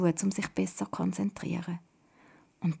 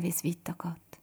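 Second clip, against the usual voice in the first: ordinary delivery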